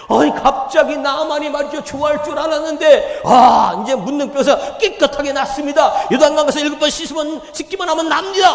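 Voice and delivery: loud, high, fervent preaching; fiery preaching. Acted. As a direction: preach it at full force to the congregation. Preacher at full force.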